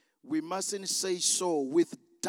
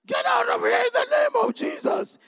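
high, strained, desperate shout; louder command; 11 dB louder than usual. Acted. Believer shouts at the mocking mountain, loud and high. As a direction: yell it far too high, overdoing the effort. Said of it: "Say it louder".

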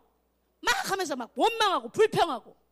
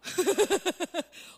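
short chuckling laugh. Real, not acted. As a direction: chuckle in a quick run. Laughs at own mock answer.

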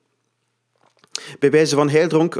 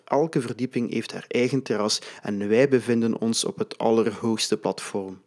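usual speaking voice

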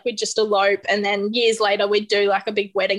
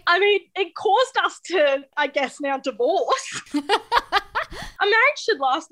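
laughing; 8 semitones higher, slower than usual. Real, not acted. Joking about ten-day marriage.

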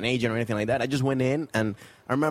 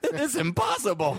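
high, drawn-out comic wail; hammed-up defeat; mock despair; 9 semitones higher, slower than usual. Acting out despairing English learner.

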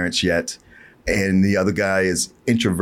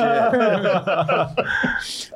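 laughing; 10 semitones higher than usual. Real, not acted. Laughs after quoting the scene.

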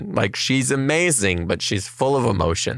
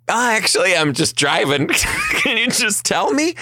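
pleading; 11 semitones higher than usual. Retold or imitated. Tom begging doctor on phone.

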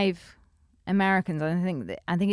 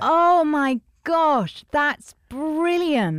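delighted; 8 semitones higher, 5 dB louder, much slower than usual. Real, not acted. Delighted reaction.

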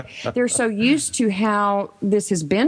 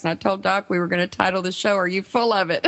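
ordinary delivery

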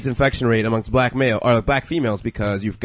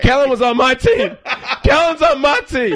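much higher, insistent; mock indignant insistence; 12 semitones higher than usual. Real, not acted. Insisting to cohost Kellen was a teammate, high mock protest. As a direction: squeal that he was on your team.

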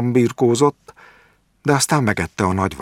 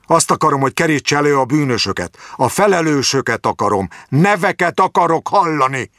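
high, pushy angry demand; 8 semitones higher than usual. A narrator's reading. Jáki demanding the doctor find the culprits. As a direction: insist on names, fast in the middle.

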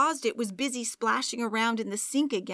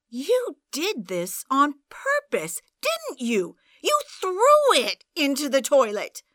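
nail him with the accusation; accusing her boyfriend of doing it on purpose; furious accusation; 6 semitones higher, 7 dB louder than usual. Acted. Accusing boyfriend of deliberate act.

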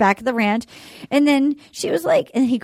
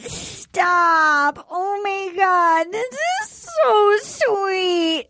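slow, high hammed-up sob; fake-cry it out slowly; hammed-up weepy gush; mock sobbing; 4 semitones higher, much slower than usual. Mocking a tearful man.

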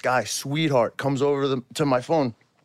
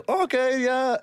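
put-on German accent; 9 semitones higher, slower than usual. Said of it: "keep this charade on".

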